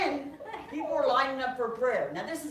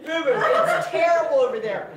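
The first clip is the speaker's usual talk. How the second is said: 1 panicked; 4 semitones higher, 7 dB louder than usual. Retold or imitated. Panicked wife tells husband.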